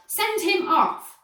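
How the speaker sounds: high, loud, slow demand. A narrator's reading. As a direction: demand it furiously. Angry father at sideline.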